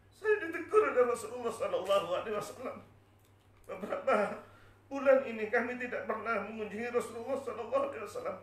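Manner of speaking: high, loud, acted lament; tearful confession. Bilal telling his family of the rebuke, tearful confession. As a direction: plead it out as if crying. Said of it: "saya menangis".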